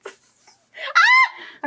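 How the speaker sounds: sudden high scream; real startled scream. Real, not acted. Co-host reacting mid-quiz, involuntary high scream. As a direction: scream straight into the microphone. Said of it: "I just screamed in the microphone".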